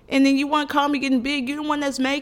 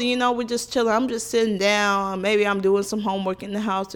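ordinary talk

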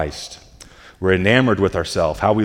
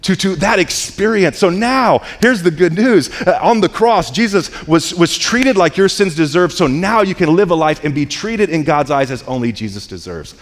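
full-force preaching; 8 semitones higher than usual. Acted. Pastor preaching the cross to the congregation, fervent. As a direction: preach it with rising fire.

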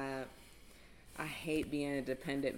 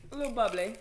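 high-pitched British impression; playful put-on British voice; mock British accent; 6 semitones higher, 3 dB louder, faster than usual. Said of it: "in the British accent".

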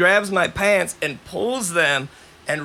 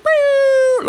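cop shout; 7 dB louder than usual. Retold or imitated. Cops arriving.

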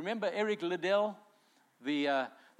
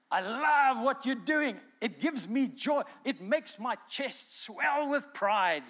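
high, drawn-out proud declaration; God's delight, acted out big and slow; exultant delight; 6 semitones higher, 4 dB louder, slower than usual. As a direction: proclaim fatherly pride, stretching the key words. Voicing God delighting in people.

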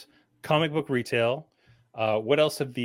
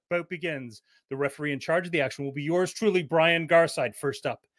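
normal talking voice

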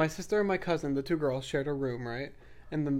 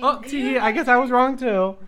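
mock giggly; 7 semitones higher, 8 dB louder than usual. Mock giggle admitting wrong.

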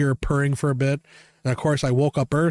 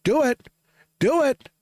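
taunting dare; 11 semitones higher, slower than usual. Retold or imitated. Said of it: "I'm like".

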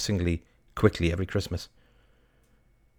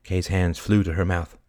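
usual speaking voice

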